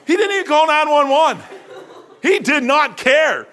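high, loud, laughing disbelief; laughing indignation. Real, not acted. Preacher laughing about George's cold response. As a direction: laugh it out in outrage.

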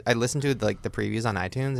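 usual speaking voice

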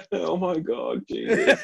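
laughing, pitch jumping way up on Jesus; laughing exclamation. Real, not acted. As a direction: gasp it out through real laughter. Listener reacting while laughing.

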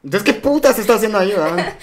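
fast, high teasing jab; mocking quote. Teasing his tough friend about her crush, fast mocking tone. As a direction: rattle it off to mock her crush. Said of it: "Y yo como".

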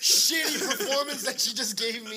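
mock-outraged retold grumble; exasperated rant. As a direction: complain in comic disbelief. Ranting at sister's shitty performance.

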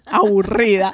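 slow, laughing drawn-out aburrida; laughing drawl. Retold or imitated. Telling the hosts how bored she felt, aburrida slowly drawn out laughing.

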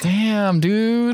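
high, stunned "damn, dude"; mock-scandalized gasp of a friend; shocked. Real, not acted. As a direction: react in disbelief, pitch jumping up. Shocked reaction to insult.

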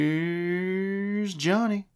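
high, drawn-out showman call; Here's Johnny. Acted. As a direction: stretch it like a late-night host.

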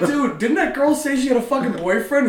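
can't believe what the buddy did; incredulous question. Retold or imitated.

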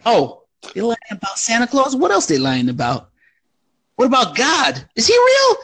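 mock dawning suspicion. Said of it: "it was like".

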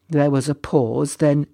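plain reading voice

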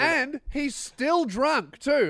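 high, loud, slow incredulity; exasperated emphasis. Real, not acted. Telling of the dumbest plan ever, exasperated emphasis. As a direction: hammer out each word in disbelief.

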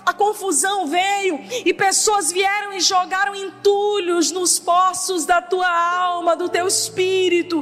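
impassioned declaration from the pulpit; rousing preacher, long driving phrases; fervent preaching. Real, not acted. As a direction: declare it with full conviction. Preaches to congregation.

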